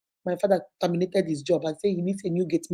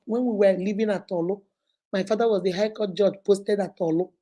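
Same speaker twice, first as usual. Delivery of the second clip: ordinary talk, nothing marked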